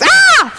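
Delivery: sudden high startled scream; imitated scream. Woman in the tube gets shocked, high scream. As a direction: yelp as if shocked by surprise. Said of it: "she screams".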